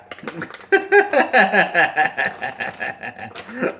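high, loud burst of laughter; helpless laugh. Real, not acted. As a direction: break up laughing. Laughing at the rotten cheese.

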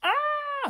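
very high, loud scream; long high "aah" scream; falling scream. A narrator's reading. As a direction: shriek as if plummeting. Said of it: "screamed Sloth".

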